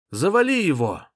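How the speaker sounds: high, loud cornerman shout; yelling from the sidelines. Acted. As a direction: yell encouragement from the corner. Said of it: "кричал Орлам".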